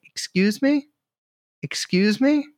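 high, oddly calm "excuse me" over the chaos; oblivious polite customer. Retold or imitated. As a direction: repeat it as if nothing is wrong. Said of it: "He's like".

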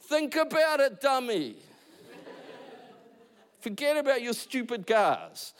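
wife's friend scolding the husband about his cars. Retold or imitated. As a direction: tell him off like a bossy friend. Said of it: "And her friend's like".

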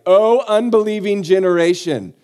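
loud, high lament; hammed-up fed-up cry to a whole crowd; exasperated outcry. Jesus to the doubting crowd, loudly exasperated. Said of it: "Now you know why he says things like".

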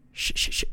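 rapid stuttered shushes; repeated sharp sh sounds; shushing. Acted. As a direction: hush someone in quick bursts. Shushing a movie interrupter.

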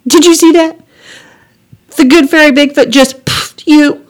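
high, loud excited squeal; amazed glee. Acted. Girl mocking poofed brother, gleeful. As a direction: crow in delighted disbelief.

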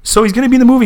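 high, loud mock fan eagerness; hopeful superfan. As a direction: ask it bursting with hammed-up hope. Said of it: "him running into George Lucas".

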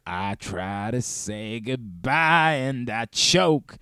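very slow husky soul-singer line; [name]. Retold or imitated. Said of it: "traipses into [name]".

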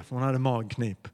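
ordinary talk

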